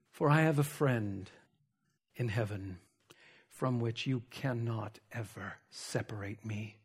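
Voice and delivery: low, very soft, measured refusal; grave acted defiance, barely voiced by the end; hushed defiance. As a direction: defy them quietly, sinking almost to a whisper. Acted defiance, sinking very quiet.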